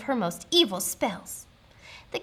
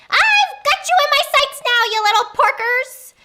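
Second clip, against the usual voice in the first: high, loud witchy crow; gloating witch cackle in the words; cackling witch; 11 dB louder than usual. A narrator's reading. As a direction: screech gleefully down at your prey. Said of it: "she cackled as she circled overhead".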